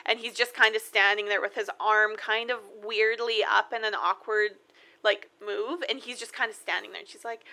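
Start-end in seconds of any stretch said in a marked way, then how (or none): none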